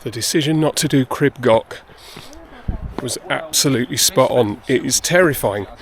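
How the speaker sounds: slightly breathless while hiking; out of breath. Real, not acted. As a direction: talk while climbing uphill, short of breath. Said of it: "perpetual state of being slightly out of breath".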